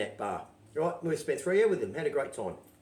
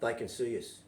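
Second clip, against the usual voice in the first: quiet; 4 semitones lower, 6 dB quieter than usual. Retold or imitated. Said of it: "turns around quietly from behind and says".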